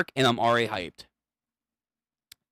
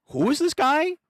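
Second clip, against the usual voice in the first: high character voice; 11 semitones higher than usual. Acted. Reading a character's line.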